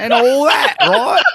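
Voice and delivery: loud, high mock Cockney; hammed-up Cockney voice; Cockney accent. Mocking how Cockneys talk, put on a Cockney accent. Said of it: "The Cockney accent".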